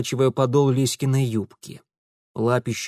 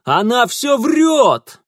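loud shout, higher, slow; yelling denial; 10 semitones higher, 7 dB louder, slower than usual. A narrator's reading. Thief yells back at the accusing girl. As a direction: shout her down. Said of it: "заорал в ответ Франт".

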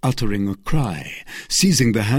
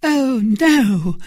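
high, loud, earnest cry; sincere, high-pitched protest; emphatic denial; 14 semitones higher, 5 dB louder than usual. A narrator's reading. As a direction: deny it with total conviction. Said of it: "accent that admitted no doubt".

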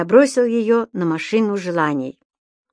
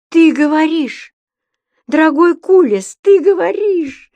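намного выше и громче, восклицая; ахнуть от изумления; изумлённо; 8 semitones higher, 6 dB louder than usual. A narrator's reading. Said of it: "изумился Понеделькус". (изумлённый возглас)

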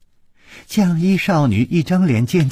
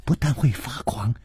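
whisper; 7 dB quieter than usual. Acted. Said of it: "黄衣少女说".

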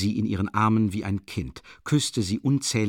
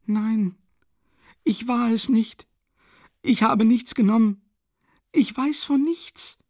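higher, desperate pleading; heartbroken cry; 12 semitones higher, 4 dB louder than usual. A narrator's reading. Sonja cries out her innocence to everyone, heartbroken. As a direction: cry out in anguish, protesting innocence. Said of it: "rief sie mit herzzerreißendem Schmerz".

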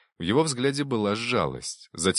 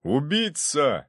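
accusing shout; 8 semitones higher, much slower than usual. A narrator's reading. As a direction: stretch the accusation out as you call it. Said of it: "снова крикнул князь Трубецкой". (обвиняющий крик, протяжно)

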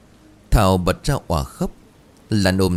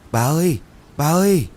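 higher, louder call into the storm; 8 semitones higher, 3 dB louder than usual. Acted. Family calling for the lost grandmother, loud high call. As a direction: shout her name into the rain. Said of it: "gọi lớn".

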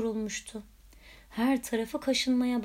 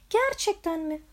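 sniffling; 7 semitones higher than usual. A narrator's reading. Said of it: "burnunu çeke çeke".

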